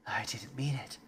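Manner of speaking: unvoiced breathy whisper. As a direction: whisper it guiltily. Whispered take.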